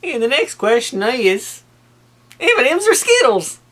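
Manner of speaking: excited. Acted. Playful question reading.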